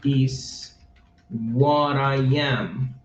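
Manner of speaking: "what I am" read slowly for learners; slow down on "what I am" so learners catch each word; slow teacher read. Shown as a demonstration. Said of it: "slow reading demo".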